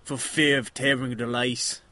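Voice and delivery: working-class English steward, drawn out at the end. Acted. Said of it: "kind of a lower class English accent".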